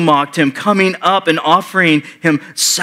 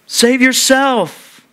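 higher, slow, drawn-out scoff; scoffing leaders; 7 semitones higher, slower than usual. Retold or imitated. Religious leaders jeering at Jesus, slow raised scoff. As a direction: lean on each taunt slowly. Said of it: "the religious leaders are saying".

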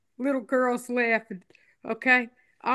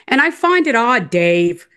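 emphatic loud; 2 semitones higher, 12 dB louder than usual. Real, not acted. Pointing out the camel to Dave.

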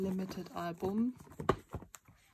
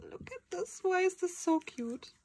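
cooing delight; 9 semitones higher than usual. Real, not acted. Cooing over the dog toy, high-pitched. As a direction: coo over something adorable.

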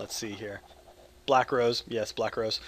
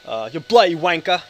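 louder, higher mock English insult; mock English accent; 5 semitones higher, 8 dB louder than usual. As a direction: put on a cartoonish English accent. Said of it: "That's not even an English accent".

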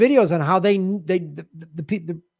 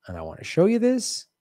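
usual speaking voice

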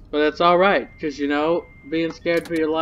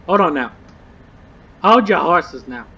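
loud, startled "whoa there"; sudden loud protest; alarmed call; 10 dB louder than usual. Real, not acted. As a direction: call it out loudly to halt a threat. Calling out at game threat.

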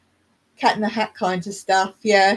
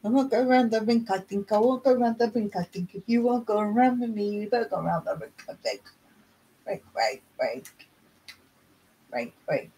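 faster and faster. Shown as a demonstration. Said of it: "That gets faster and faster".

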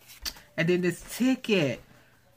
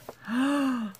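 audible gasp of delight; wowed intake of breath; 5 semitones higher, 3 dB quieter than usual. Real, not acted. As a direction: draw in a sudden gasp. Delighted by the folder design.

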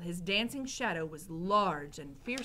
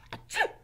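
second, louder mock sneeze; acted sneeze; 5 dB louder than usual. A narrator's reading. Gregory sneezing into his napkin, louder acted sneeze. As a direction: sneeze out an "achoo". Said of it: "sneezed into it".

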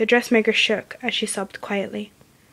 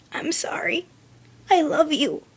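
tearful whisper; 7 semitones higher than usual. A narrator's reading. Dash's farewell.

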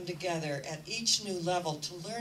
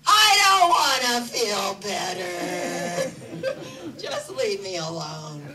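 slow, much louder wailing sob; Lucy crying; 10 dB louder, slower than usual. Retold or imitated. Lucy refuses comfort from Charlie Brown, loud wail. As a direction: wail it like a sulking child. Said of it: "she just said".

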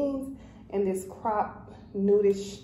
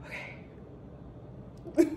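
teary laugh; 5 dB quieter than usual. Real, not acted. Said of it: "before I cry".

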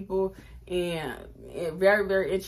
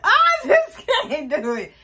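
higher, louder, laughing it out; 7 dB louder than usual. Real, not acted. Teller laughs while quoting her nervous excuse. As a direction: laugh through the confession.

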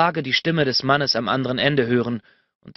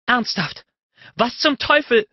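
outraged disbelief; 10 semitones higher than usual. A narrator's reading. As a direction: shoot up in disbelief.

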